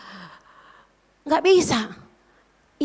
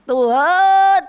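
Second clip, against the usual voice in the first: loud, raised, drawn-out call; higher, louder plea to God; crying out to God; 4 semitones higher, 6 dB louder than usual. Retold or imitated. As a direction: cry out loudly in prayer. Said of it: "saya teriak".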